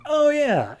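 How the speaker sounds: very high, drawn-out crowd response; mock dawning agreement. Crowd of neighbours suddenly convinced.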